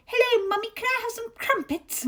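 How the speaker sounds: high-pitched child with a put-on Southern English accent; mock Southern accent. Imagined kid asking mummy for a snack, mock Southern accent. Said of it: "Jack has a Southern accent".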